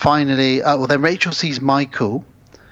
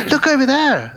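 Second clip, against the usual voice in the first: high-pitched acted alert; mock alarm; 12 semitones higher than usual. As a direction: imitate someone suddenly pointing out danger, high. Voicing someone spotting another Michael.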